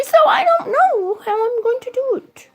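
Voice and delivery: high-pitched, exasperated wail. Real, not acted. Struggling dieter venting to viewers, exasperated wail. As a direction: let the frustration lift your voice high. Despairs over losing ten pounds.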